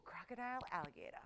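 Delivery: hushed, pondering the choice; whispered musing. Real, not acted. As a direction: whisper the two words to yourself. Musing over crocodile or alligator.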